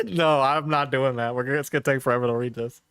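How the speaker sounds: usual speaking voice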